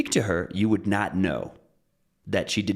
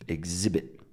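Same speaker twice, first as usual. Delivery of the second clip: "exhibit" with weight on the second syllable; stress on second syllable; 5 dB quieter than usual. Shown as a demonstration. Teacher shows where the stress is, "exhibit" stressed on second syllable. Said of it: "where is the stress? It's exhib".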